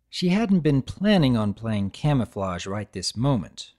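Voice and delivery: usual speaking voice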